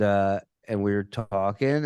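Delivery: ordinary talk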